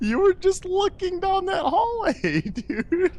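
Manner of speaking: high-pitched, squealing through laughter; laughing disbelief. Real, not acted. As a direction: squeal it while cracking up. Friend laughing at the scare.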